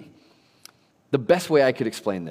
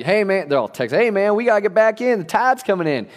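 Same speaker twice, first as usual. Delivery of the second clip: put-on higher, louder kid calls; mocking cousins; 9 semitones higher, 4 dB louder than usual. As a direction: imitate bossy cousins calling out. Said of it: "That's how they talk".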